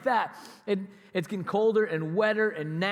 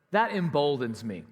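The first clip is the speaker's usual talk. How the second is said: normal talking voice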